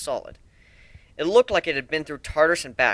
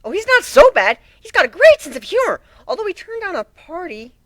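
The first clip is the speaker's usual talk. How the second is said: much higher, loud chirpy objection; bubbly Pinkie; 9 dB louder than usual. Acted. Pinkie defending the pirate to the sea dog, high and bubbly. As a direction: brush off the danger cheerfully. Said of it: "Pinky objected".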